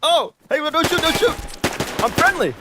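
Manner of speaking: high, loud pleading shout; panicked surrender shout. Real, not acted. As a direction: yell desperately to not get shot. Surrendering to armed player.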